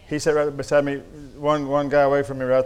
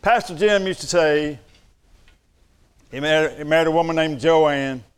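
usual speaking voice

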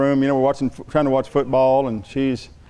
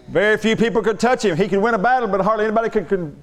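ordinary talk

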